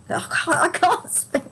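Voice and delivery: real giggle over a failing voice; laughing at own voice. Real, not acted. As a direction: laugh through it as the voice fails. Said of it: "I'm laughing now 'cause I'm going crazy".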